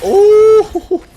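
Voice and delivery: loud high excited whoop. Real, not acted. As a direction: let out a loud excited ooh. Reaction in firefight.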